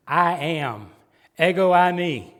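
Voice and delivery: preacher declaring Jesus's I am, loud; emphatic proclamation. Acted. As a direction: ring out ego eimi from the pulpit.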